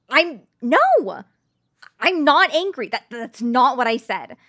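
furious shout, I'm NOT angry. Acted. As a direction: blow up, snapping the denial out. Denial erupts into shouting.

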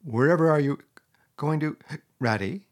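startled, half-whispered cry; a frightened friend calling after him; tearful alarm. Acted. Mole to departing Rat, alarmed and tearful: startled whispered cry. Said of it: "cried the tearful Mole".

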